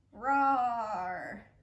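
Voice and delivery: loud roaring lion growl. Shown as a demonstration. Teacher demonstrating a lion roar for kids. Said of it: "you're gonna roar like a lion".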